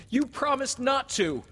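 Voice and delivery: put-on high, loud childlike accusation; upset protest. A narrator's reading. Peter accuses Wendy of growing up, loudly upset. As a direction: protest hotly at the top of the voice.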